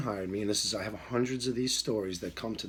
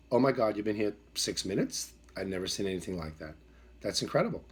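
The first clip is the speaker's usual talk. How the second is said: ordinary talk, nothing marked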